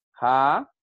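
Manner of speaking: JA said alone, drawn out and loud; syllable by syllable. Shown as a demonstration. Teacher splitting japonés for the class, JA drawn out on its own. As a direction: stretch JA as one separate syllable. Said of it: "hay que hacer es separarla".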